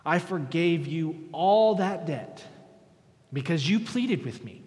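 very slow, loud master's rebuke; stern rebuke. Acted. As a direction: scold the servant, weighing each word. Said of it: "his master summoned him and said".

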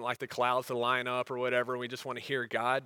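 ordinary talk

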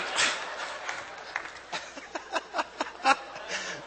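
long, high, breaking laugh; helpless laughing fit; real laughter. Real, not acted. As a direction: crack up at your own joke. Laughing at his own joke.

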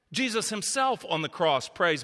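ordinary talk

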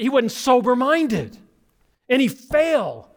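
high, urgent preacher's call; preaching at full force. Acted. Pastor urging the congregation to stop and think, preaching hard. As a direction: lift the whole church up with it.

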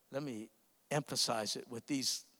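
ordinary delivery

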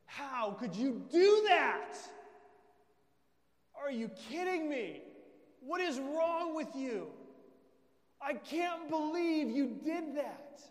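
loud, high shout of disbelief; outraged accuser. Acted. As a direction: lay into them, drawing out the shocked accusation. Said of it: "he begins to accuse you".

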